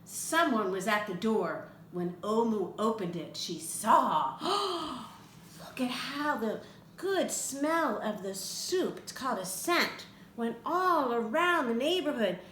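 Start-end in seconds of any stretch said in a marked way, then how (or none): none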